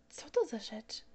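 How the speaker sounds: put-on breathy little-boy whisper; curious child whisper. Little prince asks pilot about plane, whispered child voice. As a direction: whisper the question like a wondering child.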